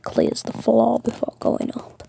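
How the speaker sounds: ordinary delivery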